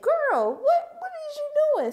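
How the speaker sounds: high, loud shocked shriek; shocked outcry. Retold or imitated. Watching Zalta make him lick her boot, shocked high shriek. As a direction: shriek it in total disbelief. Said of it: "I said".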